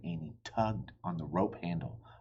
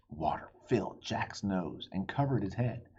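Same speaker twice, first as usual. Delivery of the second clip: plain reading voice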